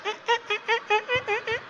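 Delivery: very high repeated game-creature squeaks; Koopa Troopa. Retold or imitated. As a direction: squeak out short, even little yelps. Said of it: "looked like a Koopa Troopa from Mario".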